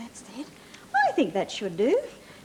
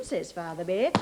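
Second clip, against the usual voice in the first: usual speaking voice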